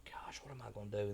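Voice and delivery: hushed whisper to himself; whispered worry. Retold or imitated. Father stuck outside son's locked bathroom, whispering his worry. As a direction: breathe it out, worried and hushed. Anxious thought at stuck door.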